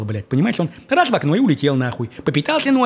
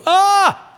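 высокий громкий короткий вскрик «А!»; изобрази её крик наслаждения; мнимый крик; 5 dB louder than usual. Retold or imitated. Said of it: "она кричала". (пересказанный высокий громкий вскрик)

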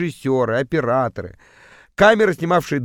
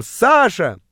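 mock journalists' cry, pitched higher; imitated excited cry; 7 semitones higher, 3 dB louder than usual. Journalists rush up mistaking him for someone else, mock cry. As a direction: call out the name like an eager reporter. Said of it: "подлетели ко мне с криком".

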